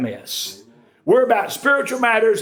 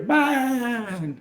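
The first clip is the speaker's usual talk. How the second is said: quick, quiet proud little "Mine"; proud frog blurt; 3 semitones higher, 5 dB quieter than usual. Retold or imitated. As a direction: let the frog brag and drop. Frog blurts, then falls.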